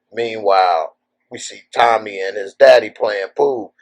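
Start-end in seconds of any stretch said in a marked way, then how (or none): none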